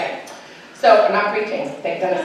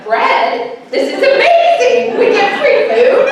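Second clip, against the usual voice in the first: much higher, louder, slow thrilled squeal; excited kid; 13 semitones higher, 9 dB louder, much slower than usual. Retold or imitated. Son at church door thrilled by the bread bag, excited. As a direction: gush like a kid who got free food. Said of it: "Sean's like".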